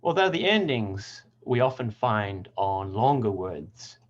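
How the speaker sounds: ordinary talk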